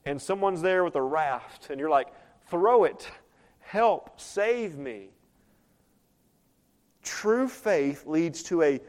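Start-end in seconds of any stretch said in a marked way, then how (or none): 2.5-5.1 urgent cry for help; desperate call. Pretend. Drowning person calling to someone with a raft, desperate cry. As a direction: call out as if going under. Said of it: "a call of desperation".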